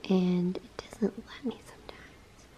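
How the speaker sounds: soft unvoiced whisper, slowing down. Real, not acted. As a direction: hush it like a secret. Showing the ring, hushed.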